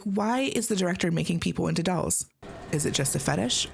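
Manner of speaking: ordinary talk, nothing marked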